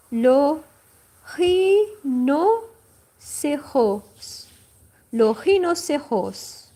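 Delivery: rhinoceros in French with the final S sounded; final S pronounced. Shown as a demonstration. Teacher shows the French word for her students, final s sounded. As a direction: rhinoceros with the r from the throat and the last s heard. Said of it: "The S is not gonna be silent".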